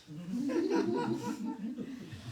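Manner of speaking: a fond, high laugh breaking out mid-story; warm laugh. Real, not acted. The preacher laughs fondly at the guru's playful words. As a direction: laugh with delight at the memory.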